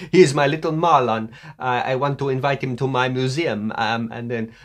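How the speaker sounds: gushing, hammed-up Italian woman; comic Italian English; mock Italian accent. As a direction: play a smitten Italian woman, on the edge of laughing. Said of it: "a beautiful young Italian woman".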